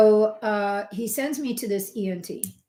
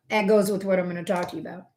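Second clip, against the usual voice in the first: usual speaking voice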